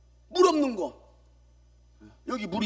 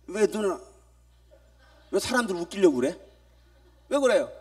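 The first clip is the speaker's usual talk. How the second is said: usual speaking voice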